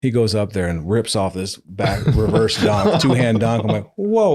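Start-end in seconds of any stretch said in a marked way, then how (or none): 4.0-4.3 high, loud amazed whoa. Retold or imitated. Coach watches a recruit's reverse dunk, amazed. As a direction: gasp it out in awe.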